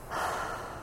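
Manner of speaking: soft breathy sigh. Real, not acted. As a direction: let out a quiet sigh. Pauses mid-thought in talk.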